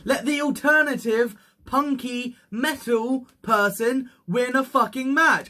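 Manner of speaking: high-pitched, insistent demand; exasperated plea. Real, not acted. Fan begging the promotion for another winner, exasperated plea.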